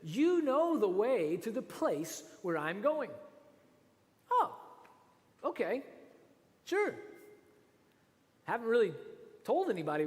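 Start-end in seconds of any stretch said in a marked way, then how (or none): 0.0-3.1 slow, deliberate quote; Jesus speaking slowly to disciples; slow Jesus line. Acted. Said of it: "He says". 4.3-7.0 sarcastic. Pretend. Sarcastic aside at Jesus' words.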